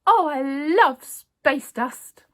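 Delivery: slow, loud forced enthusiasm; unconvincing. A narrator's reading. Newsagent eating the bogey to fool Miss Bennet, forced. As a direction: overdo the fake delight. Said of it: "he added unconvincingly".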